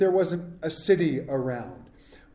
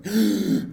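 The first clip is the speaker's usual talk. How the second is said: mock gasp; 3 semitones higher than usual. Mock panic waking up.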